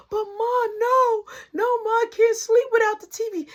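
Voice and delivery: high-pitched imitation of a pleading kid; mock little-brother protest; whining child.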